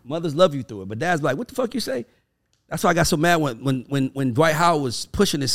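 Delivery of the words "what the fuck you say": a hard-edged dad challenge, mock stern; playing the dad calling you out; imitating a confrontational dad; mock tough dad. Dad confronting, acted quote.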